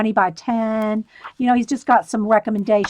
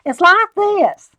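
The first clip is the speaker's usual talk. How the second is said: put-on a quick higher, slower stab at a Southern accent; 8 semitones higher, 5 dB louder, slower than usual. Trying out the little man's line in a Southern accent. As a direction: tries a hammed-up Southern drawl on the line, then drops it. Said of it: "maybe I should do that in a Southern accent".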